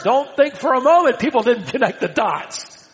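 ordinary talk

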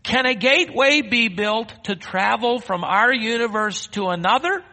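ringing, high-pitched challenge; impassioned rhetorical question. Acted. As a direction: throw the question out to the whole congregation. Preacher re-asks question with full force.

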